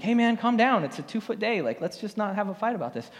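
high calming plea, easing off; placating the surfer. Retold or imitated. To the screaming surfer in the water, a high calming plea. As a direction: try to calm him down, a little high. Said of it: "I'm like".

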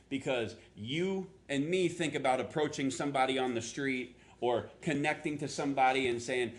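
ordinary delivery

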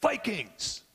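high, called-out team cheer; rally the huddle again; captain's pumped-up shout; retold rallying cry. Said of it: "And he said".

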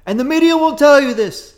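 high, loud rally voice; Trump impression. Host does Trump at rally, high loud voice. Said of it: "the really heavy breaths".

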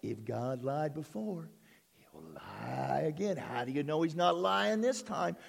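low, soft, sly whisper-like voice of doubt, lightly voiced; a hint of a tempter murmuring in your ear; tempting voice of doubt. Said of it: "who's gonna jump on your shoulder and start to speak to you".

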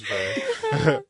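high, slow words squeezed out between laughs; can barely get the words out for laughing; laughing through it. Real, not acted. Cracking up at the Portland and New Zealand joke, laughing hard.

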